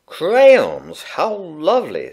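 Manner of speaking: a mother praising her son's birthday present; delighted mum. A narrator's reading. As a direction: gush over the present like a pleased mother. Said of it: "said Bernard's mum".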